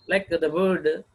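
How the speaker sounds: ordinary talk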